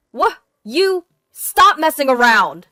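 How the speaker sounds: loud, high sputtering anger; sputter, then yell at her; outraged shout. A narrator's reading. Husband outraged by wife's answer.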